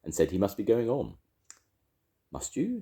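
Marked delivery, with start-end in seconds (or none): 2.3-2.8 high, gentle question; polite. A narrator's reading. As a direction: ask with mannered politeness. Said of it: "said Rabbit politely".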